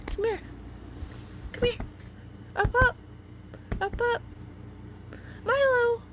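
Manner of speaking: high, loud call to a pet. Real, not acted. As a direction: coax the cat over in a high loud voice. Calling the cat to come.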